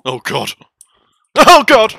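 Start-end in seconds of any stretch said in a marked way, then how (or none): 1.4-1.9 high, loud panicked shriek; panicked scream. Real, not acted. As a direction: scream as it grabs you. Panicking as monster attacks.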